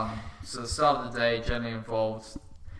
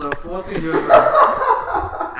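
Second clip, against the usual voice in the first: laughing; 8 semitones higher, 12 dB louder, much slower than usual. Real, not acted. Laughing at own broke story.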